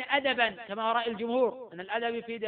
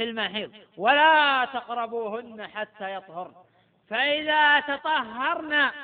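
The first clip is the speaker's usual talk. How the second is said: Quran recitation; 7 dB louder, slower than usual. Shown as a demonstration. Teacher recites Quran verse.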